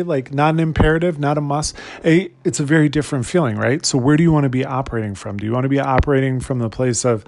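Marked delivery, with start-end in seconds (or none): none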